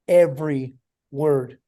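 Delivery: "every word" slowly, each word set apart; emphatic repetition. Real, not acted. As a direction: weigh EVERY, pause, then land WORD. Repeats the phrase for emphasis.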